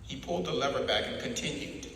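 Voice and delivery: ordinary delivery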